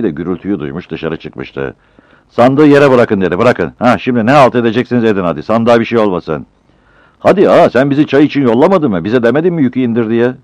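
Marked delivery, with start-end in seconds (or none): 2.3-6.5 loud, higher commanding; stern order. Acted. Shop owner orders the porters. 7.2-10.3 higher, loud complaint; loud protest. Acted. Battal protests to the owner.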